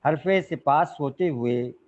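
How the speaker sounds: measured ghazal recital with long pauses; poetry recitation. Acted. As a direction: recite the couplet phrase by phrase to an audience. (ग़ज़ल पढ़ने का ठहरा हुआ अंदाज़)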